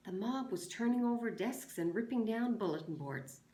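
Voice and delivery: expressive read-aloud of a novel chapter; story reading. Acted. Teacher reads the chapter to her class, expressive.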